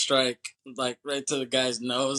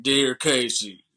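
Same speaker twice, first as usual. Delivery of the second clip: slow, louder mock Southern accent; hammed-up letter-reading in a put-on accent; cheesy accented voiceover; 5 dB louder, slower than usual. Prisoner writing to his child, cheesy accented voiceover. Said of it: "cheesy voiceover with that accent".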